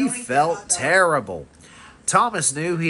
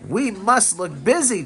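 mildly urgent, higher-pitched; 6 semitones higher than usual. A narrator's reading. Engine telling friends to look busy, mildly urgent. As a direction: press it with a touch of urgency. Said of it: "Thomas said urgently".

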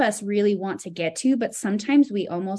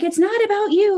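much higher, louder exasperated cry; exasperated retort; 13 semitones higher, 7 dB louder than usual. Retold or imitated. Wife snapping at her husband mid-argument, high exasperated cry. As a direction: fling it back at him, fed up. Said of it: "And I'm like".

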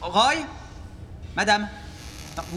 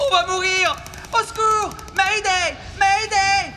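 high, loud cries for help; panicked screaming; 12 semitones higher, 3 dB louder, slower than usual. Acted. Tied above grinder, panicking.

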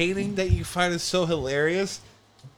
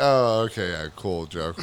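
very low, dropping away softer; mock unimpressed; 12 semitones lower, 4 dB quieter than usual. Listener reacting to an overlong joke, low and underwhelmed. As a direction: imitate a polite, underwhelmed listener.

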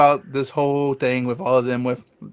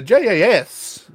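put-on slow, high, stretched-out Long Island accent; bad Long Island accent; 7 semitones higher, 4 dB louder, much slower than usual. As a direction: ham up a wrestler's fake Long Island accent. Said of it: "real stretched out Italian accent that's just bad".

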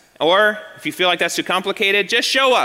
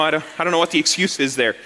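ordinary delivery

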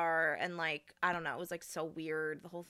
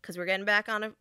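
normal talking voice

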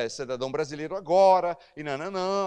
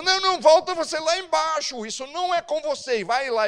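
brushing him off; 11 semitones higher, 4 dB louder than usual. Retold or imitated. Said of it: "o supervisor, falou".

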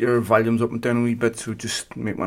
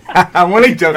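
laughing it off, loud and bright; chuckling apology after a cheeky jibe; 7 semitones higher, 12 dB louder than usual. Real, not acted. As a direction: laugh through the words I'm only joking. Laughs through teasing apology.

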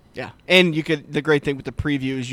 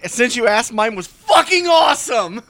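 much higher, loud, slow shout; excited shout; 8 semitones higher, 7 dB louder, slower than usual. Real, not acted. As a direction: boast at full volume. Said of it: "Settle down, buddy".

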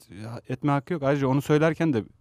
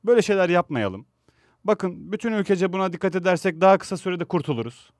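higher-pitched, pleading statement to the public; earnest public appeal; 6 semitones higher than usual. Pretend. Host models what the actor should tell fans, earnest appeal. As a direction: appeal to everyone like a responsible celebrity.

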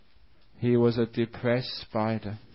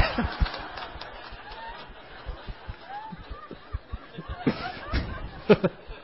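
breathless squeaky laugh; helpless wheeze of laughter; long high wheezing laugh; 4 dB quieter than usual. Real, not acted. Preacher wheezes with laughter at his punchline.

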